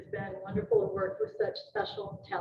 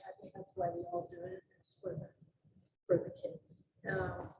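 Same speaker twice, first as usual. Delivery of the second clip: real lump in the throat; low, faltering, pausing to hold back tears; choked up; 13 semitones lower, 4 dB quieter than usual. Real, not acted. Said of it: "just in case I got choked".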